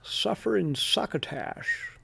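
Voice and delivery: Suffering succotash with slow, slushy lisped s's; lisping cartoon voice. Shown as a demonstration. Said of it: "kinda sound like the groundhog in Winnie the Pooh".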